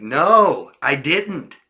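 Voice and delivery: high, loud drawn-out no; insistent false denial. Shown as a demonstration. Teacher acting a liar's denial, insistent. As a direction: protest too much, voice swooping up.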